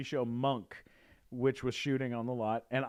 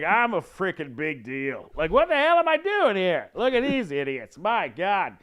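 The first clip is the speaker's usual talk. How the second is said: pompous Hollywood big shot, booming louder and higher; 7 semitones higher, 9 dB louder than usual. Retold or imitated. Manager coaching an actor to act superior, pompous and loud. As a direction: bellow like you're better than everyone. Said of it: "it's kinda like your [name] impression".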